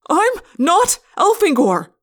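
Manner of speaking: slow, high, loud denial; frustrated yell. A narrator's reading. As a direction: shout it word by word. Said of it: "I yelled".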